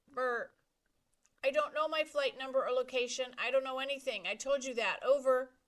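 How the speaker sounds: high, loud exasperated reply; frustrated shouting. A narrator's reading. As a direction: snap back in frustration. Brian frustrated on radio.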